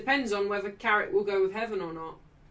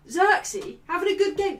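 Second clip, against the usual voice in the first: a hint of a sceptical fan, higher and louder; mock doubter; 11 semitones higher, 8 dB louder than usual. Quoting fans who doubt Xerxes, lightly mocking voice. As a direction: lightly mimic the people who'll doubt you. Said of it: "I know people were gonna say".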